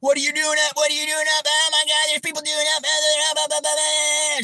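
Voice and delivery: lightly voiced shrill neighbor; mock screaming neighbor. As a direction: squeal it like a nosy neighbor. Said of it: "she's screaming out the window".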